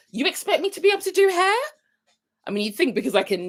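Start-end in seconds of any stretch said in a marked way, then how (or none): 0.1-1.5 high, quick disbelieving question; mock incredulous. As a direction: toss it back, rising in playful disbelief. Acted retort about being a hairdresser.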